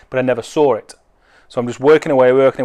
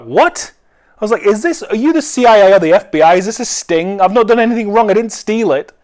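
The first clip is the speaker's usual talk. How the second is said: higher, louder, fast mock panic; 8 semitones higher, 4 dB louder than usual. Seller startled by a five grand offer, higher and louder mock panic. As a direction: blurt it out like you think it's a sting.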